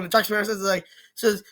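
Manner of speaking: ordinary talk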